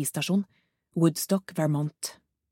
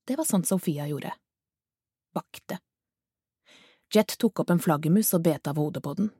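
usual speaking voice

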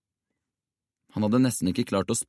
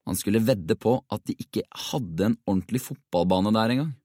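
plain reading voice

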